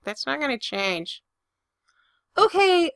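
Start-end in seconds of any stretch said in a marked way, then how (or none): 2.4-2.9 exasperated okay. Acted. Protesting a creepy remark.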